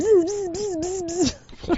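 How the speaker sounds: high buzzing robot noise. Retold or imitated. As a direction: buzz like a clunky machine. Mimicking the robot moving.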